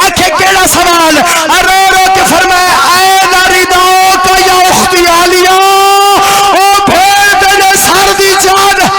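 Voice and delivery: wailing lament chant, building in waves; keen the elegy to the mourners; mourning recitation. Acted. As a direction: zakir reciting masaib, weeping and chanting.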